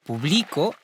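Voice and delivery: Publico with the stress on BLI; stress on middle syllable. Shown as a demonstration. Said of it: "avec accentuation sur le bi". (Publico accentué sur la syllabe du milieu, BLI)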